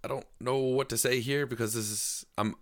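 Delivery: halting, slow retold stammer; awkward apology. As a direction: act out fumbling for words, laughing at the end. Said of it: "I'm awkward".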